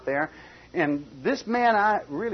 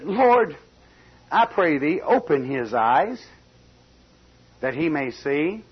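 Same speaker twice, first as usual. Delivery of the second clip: loud opening plea that settles; Lord, I pray thee, called out; fervent prayer; 4 dB louder, slower than usual. Acted. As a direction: pray it aloud with urgency. Elisha's prayer voiced.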